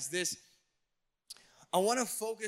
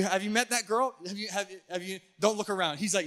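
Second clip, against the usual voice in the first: ordinary talk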